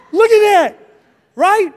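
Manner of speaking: mock proud. Showing off half-eaten cake.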